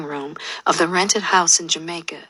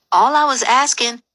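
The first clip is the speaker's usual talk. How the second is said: high, raised-voice protest; whiny, pitched-up self-defence; defensive protest; 7 semitones higher, 4 dB louder than usual. A narrator's reading. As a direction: start explaining yourself, indignantly. Protesting as she gets cut off.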